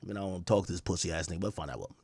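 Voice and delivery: low, trailing-off reluctant voice; grudging. Retold or imitated. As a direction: give in quietly and wearily. Grudging reply in retold call.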